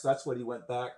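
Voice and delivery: ordinary talk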